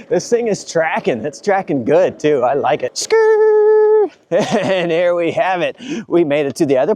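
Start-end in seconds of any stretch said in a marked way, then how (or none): 2.9-4.1 skid noise. Real, not acted. Imitated tire skid sound.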